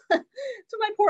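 laughing it out in embarrassment, high on "to my poor other daughter"; embarrassed real laughter breaking into the words. Real, not acted. Mother laughing at what she blurted to her daughter, laughing.

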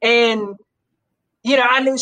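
ordinary delivery

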